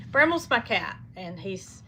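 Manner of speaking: ordinary talk, nothing marked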